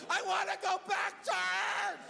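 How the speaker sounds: high-pitched, hammed-up terrified wail; panicked child's scream. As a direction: shriek like a scared kid begging to leave. Terrified son clinging to guardrail.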